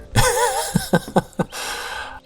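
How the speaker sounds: a burst of high, rueful laughter; short real giggle. Real, not acted. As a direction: laugh at yourself. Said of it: "I grin and I laugh now".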